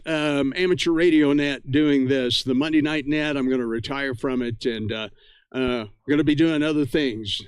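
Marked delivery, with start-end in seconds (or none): none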